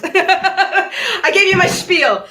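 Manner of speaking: laughing through the words. Real, not acted. Host laughing as she hands the turn to her guest, laughing. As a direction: chuckle it out as you hand over.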